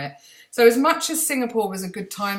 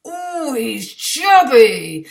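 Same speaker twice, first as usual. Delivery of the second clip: slow, high, loud doting guard voice; beaming Indian guard calling the boy chubby; 6 semitones higher, 10 dB louder, much slower than usual. Retold or imitated. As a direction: coo it like an adoring guard. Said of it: "toes and go".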